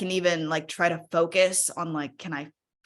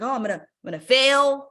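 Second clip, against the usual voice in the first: anxious inner voice; 8 semitones higher, 6 dB louder than usual. Retold or imitated. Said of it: "For me in this webinar, it's like".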